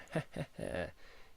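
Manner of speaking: a quiet, airless chuckle; a little huffed laugh at the score; soft breathy laugh. Real, not acted. Streamer chuckling at the crushing engine score, soft breathy laugh.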